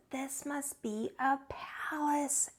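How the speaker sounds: slow, hushed gasp of wonder; awed whisper. Acted. Bear gasping at the furniture, whispered. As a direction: breathe it out amazed. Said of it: "Corduroy gasped".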